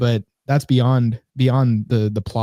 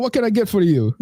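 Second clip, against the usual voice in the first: put-on failed Caribbean accent; 7 semitones higher than usual. Deli worker greets a customer, botched Caribbean accent.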